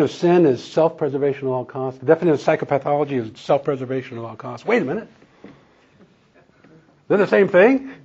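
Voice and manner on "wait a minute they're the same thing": a startled "wait, what?"; surprised realization. Retold or imitated. Speaker recalling realizing sin and psychopathology match, surprised. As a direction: pitch jumps up high in sudden discovery. Retelling his own realization.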